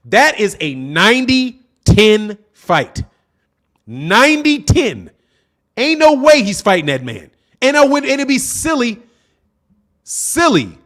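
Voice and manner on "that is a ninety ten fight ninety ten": slow emphasis. Real, not acted. Insisting on the odds.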